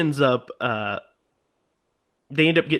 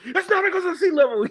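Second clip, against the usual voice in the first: high-pitched, fast imitated outburst; mock freak-out; 4 dB louder, faster than usual. Guide freaks out over sea level, mock outburst. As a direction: burst out in hammed-up exasperation. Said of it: "Freaks out".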